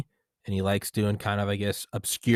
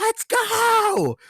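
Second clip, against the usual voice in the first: high, louder cheer; pitched-up celebratory whoop; excited yell; 5 dB louder than usual. Retold or imitated. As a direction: shout it like you just won. Honking horn, celebrating.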